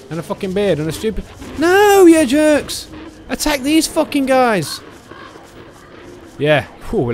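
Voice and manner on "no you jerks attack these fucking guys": high, loud, drawn-out yell; exasperated shout. Real, not acted. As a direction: shout the order in frustration, stretching it. Ordering herd to attack enemies.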